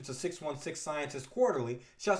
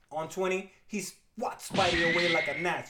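normal talking voice

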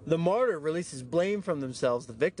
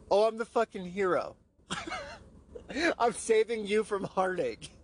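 higher, mock-heroic boast; mock-proud hero voice; sarcastic self-mockery; 7 semitones higher than usual. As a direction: puff yourself up sarcastically.

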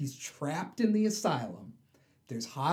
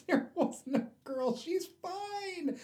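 high, gleeful villain voice; Joker voice; slower than usual. Retold or imitated. Joker telling Batman the girl was a lie, high and gleeful. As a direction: play the Joker savoring the trick, pitched up.

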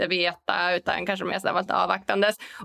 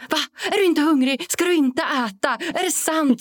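mock shocked, higher and louder; shocked coworkers; 7 semitones higher, 3 dB louder than usual. Office coworkers shocked she isn't eating lunch, mock astonished. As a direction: play the shocked office colleague. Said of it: "de tycker det är så chockande".

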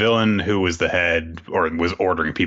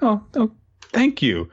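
put-on high, breathy grateful girl; 13 semitones higher than usual. Girl rescued by Barrett, thanking him in a high voice. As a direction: gush your thanks, high. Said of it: "the girl is like".

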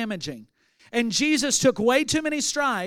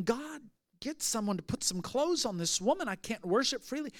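hushed inner thought; 8 dB quieter than usual. Retold or imitated. Inner thought while judging woman.

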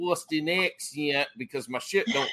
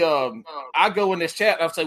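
normal talking voice

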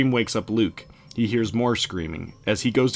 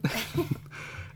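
cackle; 7 dB quieter than usual. A narrator's reading. After Maisie's scream.

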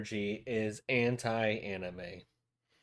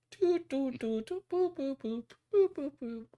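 very high toy robot boops; toy robot beeps. Pretend. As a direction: beep like a little toy robot. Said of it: "I am a robot".

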